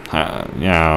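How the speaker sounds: ordinary talk, nothing marked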